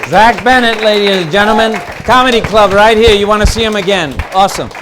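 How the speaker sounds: rally applause for the comic; big comedy-club host projection; stage announcer. Real, not acted. Host takes the stage over applause.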